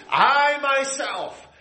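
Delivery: very slow, high, near tears; overwhelmed divine proclamation; emphatic voice of God. Acted. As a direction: stretch out "I myself" in awe. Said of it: "the I is emphatic".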